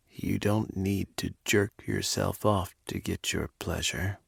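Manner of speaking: a lover reassuring him in a quiet voice; softly. Acted. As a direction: say it softly and close. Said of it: "he said softly".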